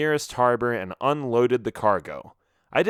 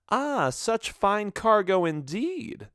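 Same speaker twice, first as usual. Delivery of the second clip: higher, slow, sly woman's voice; woman admires stolen crates, higher and slow; sly lady; 9 semitones higher, slower than usual. A narrator's reading. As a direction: savour the words like a cat eyeing prey. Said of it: "she said".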